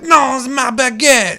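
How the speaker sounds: slow, loud, high mock French refusal; mock French accent. Ubisoft's boss refusing again, slow loud mock French accent. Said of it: "With my perfect accent".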